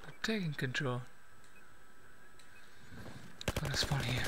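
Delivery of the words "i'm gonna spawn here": whispering. Real, not acted. Quiet planning mid-match.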